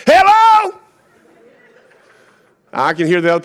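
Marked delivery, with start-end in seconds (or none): 0.1-0.8 high, blaring phone greeting; loud phone yell. Retold or imitated. Preacher imitating older man answering phone in church, yelled. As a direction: holler into the phone, oblivious to the room. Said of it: "he wasn't quiet about it".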